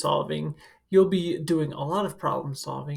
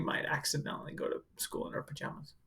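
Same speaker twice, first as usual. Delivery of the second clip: fast, softer whisper, like a guilty secret; sneaky whisper; 2 semitones lower, 13 dB quieter, faster than usual. Acted. Teacher admitting kids attend in pajamas, whispered. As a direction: let it slip as a quick hushed confession. Confessing online-school pajama habit.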